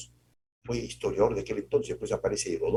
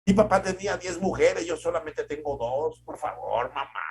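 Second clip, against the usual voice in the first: exasperated son; 6 semitones higher, 3 dB louder than usual. Retold or imitated. Son answering mother, exasperated.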